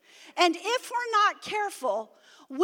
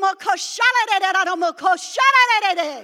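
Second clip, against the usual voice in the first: speaking in tongues; 3 semitones higher, 10 dB louder than usual. Shown as a demonstration. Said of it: "tongues shown".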